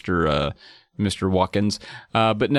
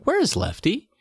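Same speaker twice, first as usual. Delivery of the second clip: higher-pitched mom voice; lightly acted mom, pitched up; 9 semitones higher, slower than usual. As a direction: ask it as a puzzled mother. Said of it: "And Mom's like".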